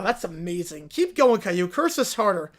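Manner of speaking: ordinary delivery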